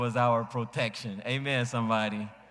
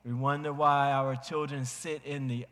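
normal talking voice

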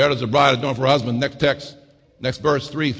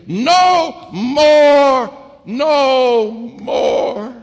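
much higher and louder, crying shout; preaching at full force; 6 semitones higher, 5 dB louder, much slower than usual. Acted. Preacher shouting the promises over the organ, full-force whoop. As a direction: whoop it to the top.

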